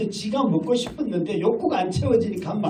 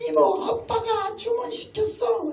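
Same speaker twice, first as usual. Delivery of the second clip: put-on high, slow, sing-song kid voice; child's voice; slower than usual. Child telling the visiting aunt dad ordered, high kid voice. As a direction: chirp it like a proud little kid.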